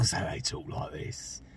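low, hushed Cockney menace; menacing whisper. Shown as a demonstration. Introducing the menacing Cockney accent in a low whisper. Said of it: "menacing ones".

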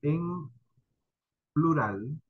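ordinary delivery

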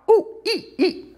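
high squeaky squeals for the squished toy bunny. Pretend. Therapist voicing the toy bunny being squished, squealing. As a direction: squeak like a squashed stuffed rabbit. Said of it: "Poor bunny's squealing".

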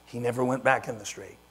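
ordinary talk

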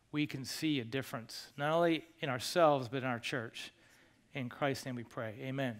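soft, hushed pastoral prayer voice; quiet prayer. Acted. Pastor praying over his congregation, hushed and earnest.

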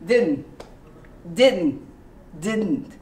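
"didn't" with the tongue up, barely an "un"; clipped didn't. Shown as a demonstration. Teacher drilling correct "didn't". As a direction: tap out "didn't" three times. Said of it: "And it's pronounced".

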